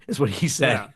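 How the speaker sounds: ordinary talk, nothing marked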